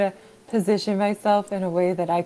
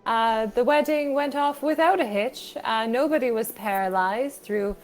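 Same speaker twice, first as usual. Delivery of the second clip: attempted British accent. Player recapping the last session for the group, attempted British accent. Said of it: "worse British accents".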